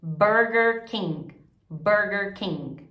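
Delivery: slow "Burger King" with American R; teacher's slow English "Burger King". Shown as a demonstration. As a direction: model "Burger" with curled R, not guttural. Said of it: "trabalhar essa pronúncia do R".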